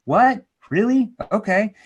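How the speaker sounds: high, disbelieving questions; incredulous. Real, not acted. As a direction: react like it makes no sense. Mock disbelief at rebuttals.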